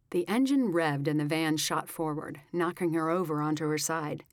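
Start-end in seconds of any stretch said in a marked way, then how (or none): none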